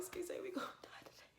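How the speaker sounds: fast, high, whispered panic; breaking down whisper. Retold or imitated. Breaking down on the rocks, fast frightened whisper. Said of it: "I started breaking down".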